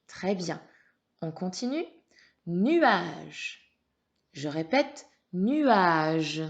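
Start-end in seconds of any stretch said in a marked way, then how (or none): 2.5-3.8 Nuage drawn out with emphasis; emphatic dictation. Shown as a demonstration. Teacher has pupil listen for syllable, Nuage emphatic and stretched. 5.3-6.4 nuage loud and drawn out; emphatic dictation. Shown as a demonstration. Teacher repeats for the pupil, nuage loud and stretched.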